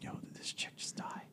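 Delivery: barely audible whisper; hushed retelling of what went through the head; inner-thought whisper. Retold or imitated. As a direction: whisper it like a private panicked thought. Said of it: "in my head, I'm like".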